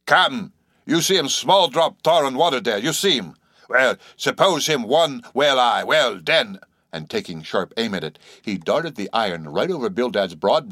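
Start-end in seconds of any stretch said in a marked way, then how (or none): 0.1-6.6 higher, louder broken-English harpooneer; cried out, harpooneer's accent. Acted. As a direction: call out the target in pidgin. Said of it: "cried out in some such way".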